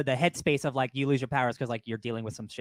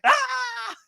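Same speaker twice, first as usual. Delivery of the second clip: high-pitched fanboy shriek; excited high shriek. Retold or imitated. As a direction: squeal way up high with glee. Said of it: "I was like".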